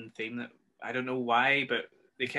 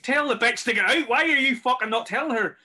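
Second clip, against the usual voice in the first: furious yell, much higher and louder; screaming at the TV; 13 semitones higher, 7 dB louder than usual. Retold or imitated. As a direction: scream at the screen in frustration. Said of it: "I was just screaming at the scene".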